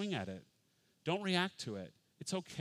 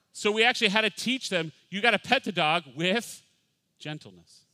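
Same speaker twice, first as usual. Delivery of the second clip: ordinary talk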